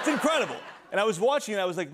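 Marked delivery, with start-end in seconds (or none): 0.0-0.6 high, gushing praise; a sudden high burst of fandom. Acted. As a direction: rave about it in a high voice. Gushing about the show.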